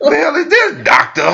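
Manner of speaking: high, whiny outraged question; mock complaining wife. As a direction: squeal it like the pained wife. Voicing wife's pained complaint.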